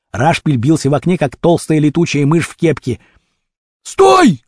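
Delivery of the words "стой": high, loud short yell; shouted command. A narrator's reading. Vasya grabs Rashpil by the sleeves, shouting. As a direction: shout to stop the fugitive. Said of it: "крикнул Вася".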